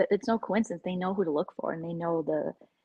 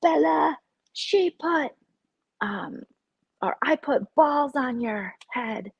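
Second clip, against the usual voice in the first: mocking the hags; 5 semitones higher, 4 dB louder than usual. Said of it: "they're like".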